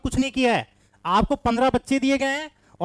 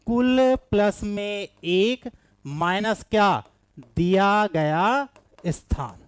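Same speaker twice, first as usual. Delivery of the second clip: very slow, word by word, with pauses; stretched-out, deliberate teaching delivery; slow dictation; much slower than usual. Real, not acted. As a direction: dictate a formula for note-taking. Teacher recites formula for students.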